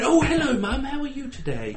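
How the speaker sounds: high, polite, well-educated English voice; Mockney mask dropped for high, proper English; well-spoken switch from Mockney. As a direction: pitch it up, all nicely spoken for Mum. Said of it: "That's Mockney. That's putting it on".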